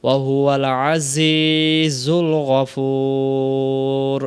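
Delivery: slow chant on a level tone, long held vowels; Quranic recitation. Shown as a demonstration. Teacher modelling the corrected verse, slow chanted recitation. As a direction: draw out the final word of the verse.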